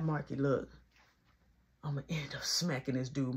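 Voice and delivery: quiet, low confiding warning; hushed aside. Retold or imitated. Teller quietly warning Marky he might hit the dude, hushed and low. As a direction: keep it between you and Marky.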